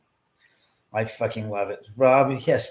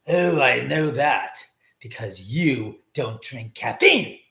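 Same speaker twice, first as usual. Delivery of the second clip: ordinary talk, nothing marked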